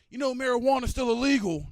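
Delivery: loud high yell at the neighbor. Retold or imitated. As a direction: shout it across the yard like a scold. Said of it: "old dude yell out".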